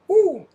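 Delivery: short, high, loud "woo"; excited whoop. Real, not acted. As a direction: let out a sudden whoop of delight. Cheering the new data features.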